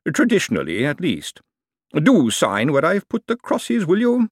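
smooth, knowing lawyer holding forth; lawyer's voice. A narrator's reading. Lawyer explaining the lease to Miss Dearhart, lightly voiced. Lawyer asks her to sign.

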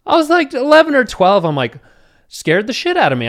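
high, loud and animated; animated disbelief. Real, not acted. Teller recalling the condom box, high excited disbelief. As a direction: tell it with big disbelief.

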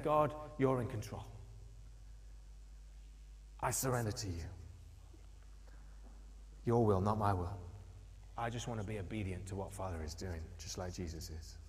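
surrendering prayer. Pretend. Believer surrendering to God in prayer, quiet and tender. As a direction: pray it quietly, yielding. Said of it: "It's about me saying".

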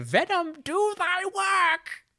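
very high, loud yell with drawn-out final words; theatrical yell. Acted. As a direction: yell a hammed-up attack cry.

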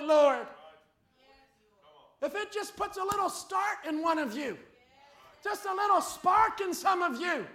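loud, rising preacher's cry to the flock; fiery preaching. Acted. Pastor pleading with the congregation, loud fiery preaching. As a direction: preach it out, pushing hard on every phrase.

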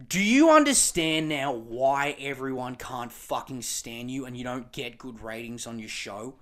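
ordinary talk

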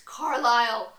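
very loud, acted cry for help; dramatic, desperate calling out; agonized call. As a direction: shout the name in agony. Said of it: "called agony in his perfect voice".

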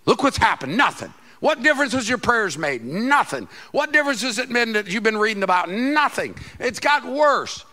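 loud, high taunting voice, each Nothing hammered down; mocking accuser. Preacher voicing the lie that cripples Christians, loud taunting jeer. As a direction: jeer at them like the voice of doubt.

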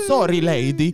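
loud, high mock-Italian apology; imitated Italian accent. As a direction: mimic a friend's put-on Italian accent, loudly. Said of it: "talking in English with an Italian accent".

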